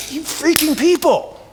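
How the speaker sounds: sudden high, exasperated snap at the disciples; fed-up teacher losing patience with his followers; exasperated outburst. Retold or imitated. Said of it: "He gets mad".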